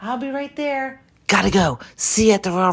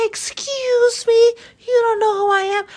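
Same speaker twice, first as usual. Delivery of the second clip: indignant; 12 semitones higher, 3 dB louder than usual. Retold or imitated. Indignant reply to a recognizing fan.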